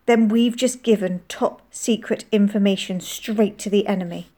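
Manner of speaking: usual speaking voice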